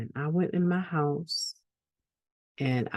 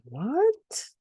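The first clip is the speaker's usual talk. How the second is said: high, rising incredulous "what"; startled disbelief; 12 semitones higher than usual. Retold or imitated. Husband hearing his wife's story, startled. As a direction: imitate a husband caught off guard. Husband reacts to her account.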